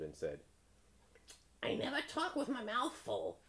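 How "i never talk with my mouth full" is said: very high-pitched bird; crow voice. A narrator's reading. Crow explaining himself to the frog, high crow voice.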